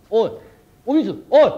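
高く大きな声で女房を呼ぶ; 慌てて大声で呼びつける; 慌てて呼ぶ. Acted. 慌てて女房を呼ぶ. (慌てた大声で女房を呼ぶ)

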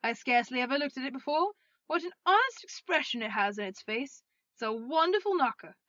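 delighted loud exclaiming; Scrooge. Acted. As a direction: marvel aloud, loudly and gleefully. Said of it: "cried Scrooge".

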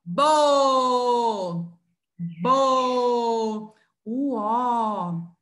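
BO held long and loud, the O stretched out; teacher sounding out "Bo", vowel sustained; syllable demo. Shown as a demonstration. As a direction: draw out "Bo, bo" so the O rings clearly. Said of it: "ó?".

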